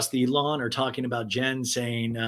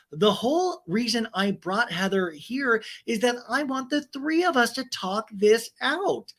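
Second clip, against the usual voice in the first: Tamara; 9 semitones higher than usual. Acted. Castmate justifying herself in a talking head, mock earnest. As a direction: plead it in a put-on high voice. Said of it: "Tamara in a talking head goes".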